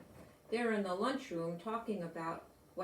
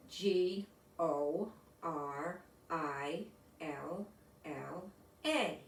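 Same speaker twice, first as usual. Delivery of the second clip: slow, lower, letter by letter; spelling out letters; 4 semitones lower, much slower than usual. Acted. Teacher spells the word on the board for her class, slow and deliberate. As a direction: sound out each letter slowly for the class.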